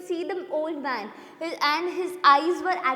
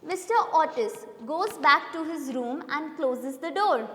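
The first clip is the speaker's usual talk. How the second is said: ordinary delivery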